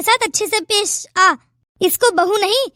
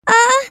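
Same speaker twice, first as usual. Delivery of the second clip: a sharp, louder cry of pain; pained groan; 5 dB louder than usual. Acted. Injured daughter-in-law cries out in pain. Bahu hurt after fall.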